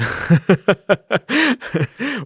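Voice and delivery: high, loud burst of laughter; laughing at a second-place finish; big laugh. Real, not acted. Laughing at their placing.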